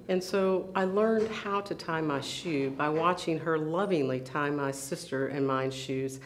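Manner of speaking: usual speaking voice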